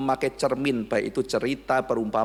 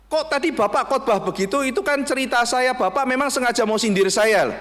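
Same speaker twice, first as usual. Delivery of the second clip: high, louder accusing voice; indignant accusation; 10 semitones higher, 4 dB louder than usual. Retold or imitated. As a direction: accuse the pastor of mocking you. Church member accuses the pastor.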